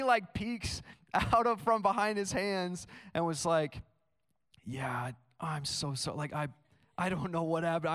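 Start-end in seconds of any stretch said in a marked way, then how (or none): 4.6-7.9 hushed, hesitant mock-guilty voice; nervous, sheepish confession. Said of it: "he's hiding his face".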